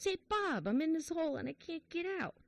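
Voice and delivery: high-pitched, urgent storyteller's plea; calling for help. Acted. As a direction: act out hailing a friend from down a hole. Trapped man calls to friend in joke.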